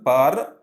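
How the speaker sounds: "per" said alone as one beat; isolated syllable. Shown as a demonstration. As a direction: set "per" apart as the second syllable. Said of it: "another syllable".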